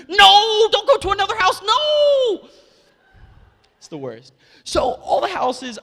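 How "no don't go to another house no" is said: high, loud panicked scream of no. Retold or imitated. Imagined neighbors screaming at the late trick-or-treater. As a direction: scream it in horror. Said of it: "they're screaming".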